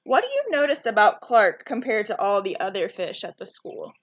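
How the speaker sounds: plain reading voice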